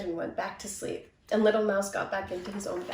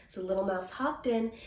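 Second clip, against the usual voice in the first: plain reading voice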